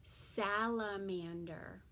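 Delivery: "Salamander" said slowly, syllable by syllable; slow modelled word. Shown as a demonstration. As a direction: stretch out "Salamander" slowly for the child. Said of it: "Say it again".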